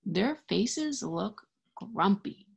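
ordinary delivery, nothing marked

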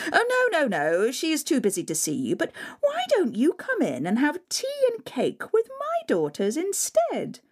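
high, fake-sweet with a laugh; sugary stepmother. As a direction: brush him off, falsely charming.

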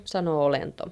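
ordinary delivery

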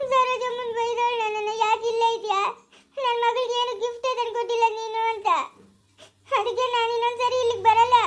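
high tearful wail; hurt, crying tattle. Acted. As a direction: sob out the complaint, pitched high. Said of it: "ಯಾಕೆ ಅಳ್ತಾ ಇರೋದು?".

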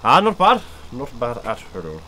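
lightly Irish, easygoing; a touch of Irish brogue; Irish accent. Acted. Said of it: "Ooh, Irish".

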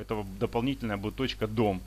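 ordinary delivery

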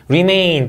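'Remain' with MAIN stretched; second-syllable stress. Shown as a demonstration. Teacher drilling word stress, second syllable strong. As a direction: lean on MAIN in 'Remain', then fall. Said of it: "이음절 강세".

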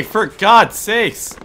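angry, slow shout; exasperated shout. Real, not acted. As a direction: yell in disbelief. After being shot by teammate.

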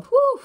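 a loud, high exhale of feeling; high emotional whew. Real, not acted. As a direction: let the emotion out in one breath. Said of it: "I'm getting really emotional".

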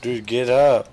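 loud, high exasperated yell; frustrated shout. Real, not acted. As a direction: shout at the screen. Yelling at game character.

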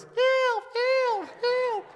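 very high squeaky cries of help; mocking kid voice. Uncle teasing the nephew about the river, squeaky mock cries. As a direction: uncle mimicking a tiny panicked boy. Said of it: "my uncle still makes fun of me".

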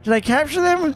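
high-pitched little monster reporting to his boss; high squeaky character voice. Pretend. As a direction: squeak it out nervously, like a timid minion. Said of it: "this voice makes me so out of breath".

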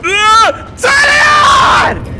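very high, loud, slow yell; panicked shout. Real, not acted. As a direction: scream it in panic.

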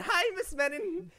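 high, singled-out mock cheery hello; big-grin greeting. Game master echoing a player waving at Menon, high grinning mimicry. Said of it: "with a big grin".

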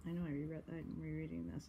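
plain reading voice

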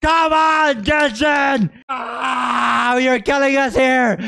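high, frustrated cry of protest; exasperated shout. Real, not acted. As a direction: yell at them in frustration. Pleading with the game's makers.

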